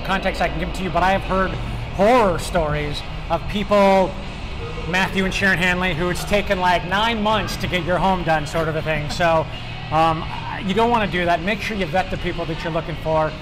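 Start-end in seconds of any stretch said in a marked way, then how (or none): none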